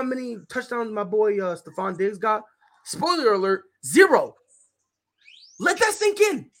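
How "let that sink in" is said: higher, pointed emphasis; emphatic high repeat. Real, not acted. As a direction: hammer the point home. Repeated catchphrase, raised pitch.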